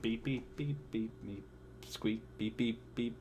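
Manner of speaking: soft, spoken beeps and a squeak; half-hearted droid beeping; imitating R2-D2 beeps. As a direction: say the beeps quietly like a little robot. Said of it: "I can't do his beeps".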